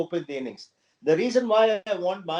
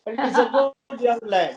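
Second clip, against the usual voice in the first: higher, louder praise; emphatic praise; 4 semitones higher, 5 dB louder than usual. Real, not acted. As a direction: lift it up with conviction. Emphatic praise of young player.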